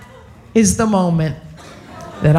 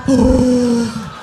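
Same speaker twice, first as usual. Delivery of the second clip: shocked gasp; 2 semitones higher than usual. Acted. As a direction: gasp in mock horror. Shock at own words.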